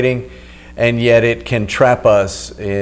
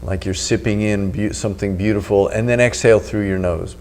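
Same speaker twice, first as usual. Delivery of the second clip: right in your ear; 3 semitones lower, 7 dB quieter than usual. Acted. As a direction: close and calm, guiding the breath. Leads a breathing meditation.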